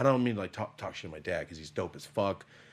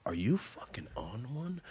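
imitating slurred dad; 2 semitones higher, 4 dB quieter than usual. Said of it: "I heard something in his voice".

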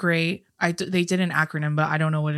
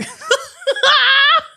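high, louder squeal of laughter; 7 dB louder than usual. Real, not acted. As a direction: let a high laugh burst out. Laughing after the Avatar joke.

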